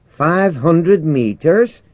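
high, loud, slow disbelief; incredulous question. Acted. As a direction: draw it out as a stunned question. Jimmy reacts to order to jump.

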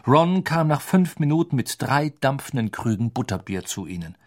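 ordinary delivery

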